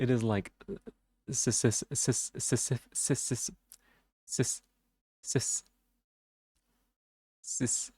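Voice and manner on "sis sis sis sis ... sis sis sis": stuck repeating sis, stuttering; real stutter on the s sound. Real, not acted. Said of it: "'cause I stutter".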